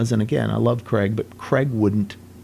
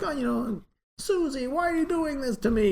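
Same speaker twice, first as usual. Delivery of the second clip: put-on high whiny Hungarian-accented plea; Gabor impersonation; 4 dB quieter than usual. [name] imitating Gabor pleading with his wife, whiny Hungarian accent. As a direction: whine at Susie in a higher, put-on Hungarian voice. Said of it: "started doing his Gabor impersonations".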